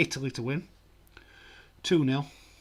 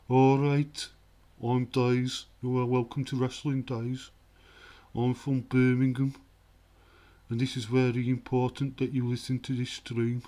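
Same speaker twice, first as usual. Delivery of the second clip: loud, exaggerated Brummie voice; Birmingham accent; 2 semitones higher, 7 dB louder than usual. Shown as a demonstration. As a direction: put on a thick Birmingham accent. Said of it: "That's kind of the Birmingham accent".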